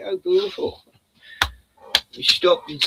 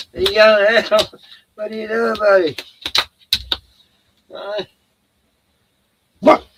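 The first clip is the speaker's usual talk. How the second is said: higher, louder eager push at the dog; urging the dog; 7 semitones higher, 8 dB louder than usual. Real, not acted. Owner urges Bruno out, higher and louder.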